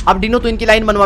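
calling out orders. Real, not acted. Streamer shouting orders to teammate Dino, loud. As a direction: call it out loud and high over the game.

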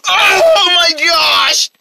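high, very slow wailing shout; exasperated scream. Acted. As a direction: howl it out, driven mad by noise. Noise interrupts him again.

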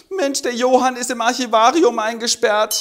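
high, loud wailing cry; hammed-up wail. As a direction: howl out the bad news. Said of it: "die Schwermut heult".

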